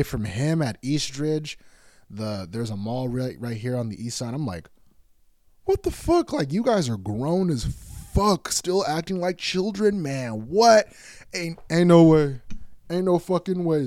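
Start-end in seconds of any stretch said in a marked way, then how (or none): 5.6-13.9 high-pitched, incredulous outburst; hammed-up exasperation at grown people acting childish; exasperated disbelief. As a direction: react appalled, voice shooting up high. Exasperated reaction to couple's antics.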